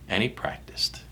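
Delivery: last echo in a whisper; whispered repeat. Acted. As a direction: trail off into a whisper. Repeated fading refrain.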